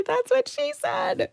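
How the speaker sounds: high, slowed cheeky punchline; cheeky joke line. Pretend. Host sneaking in a joke line, high and slow. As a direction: slip in the naughty joke in a put-on voice. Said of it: "stuff that made me wanna say".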